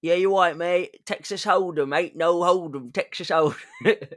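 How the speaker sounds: mock British pop-star impression. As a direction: ham it up as a matey Brit. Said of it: "with a British accent".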